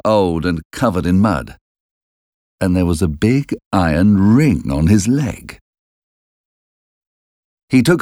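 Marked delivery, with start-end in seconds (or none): none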